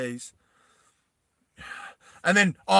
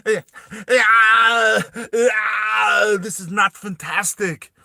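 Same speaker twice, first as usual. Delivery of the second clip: dramatic shout; 5 semitones higher, 7 dB louder, much slower than usual. Acted. Mock dramatic movie ending.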